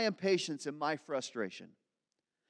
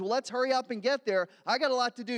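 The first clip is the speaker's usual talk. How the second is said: faster and louder, impatient rush; hurried Jesus; 5 semitones higher, 5 dB louder, faster than usual. Pretend. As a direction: rattle it off in a hurry. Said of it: "It's not like Jesus says".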